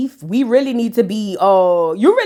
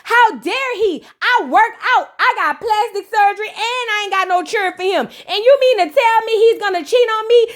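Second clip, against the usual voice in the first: much higher, outraged wife voice; indignant wife; 8 semitones higher than usual. Retold or imitated. Vlogger voicing the betrayed wife, high and outraged. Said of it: "And she was like".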